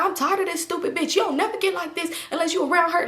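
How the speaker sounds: high-pitched imitation of an exasperated man; fed-up cussing out. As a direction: complain as if at your wits' end. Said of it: "the whole time he cussing Toxic out".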